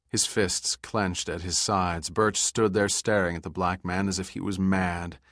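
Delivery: ordinary delivery, nothing marked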